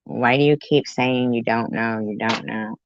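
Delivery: usual speaking voice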